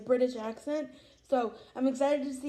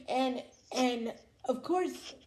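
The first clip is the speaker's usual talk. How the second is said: ordinary delivery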